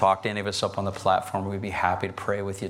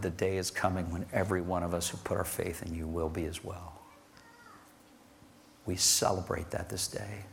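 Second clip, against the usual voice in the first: soft prayer; 2 semitones lower, 5 dB quieter than usual. Acted.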